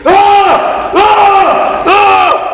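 high strained shouts of lifting; imitated straining yells. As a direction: yell like you're lifting to failure. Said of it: "All you would hear ... you hear".

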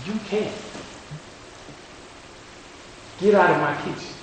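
the Lord. Retold or imitated. Preacher voicing the Lord correcting him, soft and firm. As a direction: set someone straight like a dad, quietly. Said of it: "kind of like a coach slash dad".

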